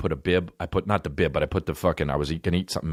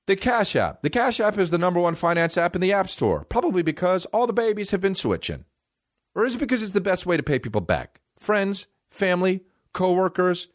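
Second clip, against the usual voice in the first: higher-pitched, mock-peppy sponsor read; 10 semitones higher than usual. Host selling the Cash App, high-pitched ad read. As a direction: pitch it up high and keep it there.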